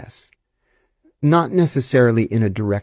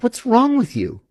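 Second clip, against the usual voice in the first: incredulous; 11 semitones higher than usual. Retold or imitated.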